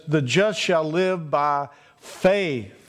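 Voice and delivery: very slow, building to "by faith" at the end; deliberate, unhurried emphasis on "by faith"; stress on by faith. Shown as a demonstration. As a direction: spell it out slowly, landing on "by faith". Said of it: "and the emphasis is".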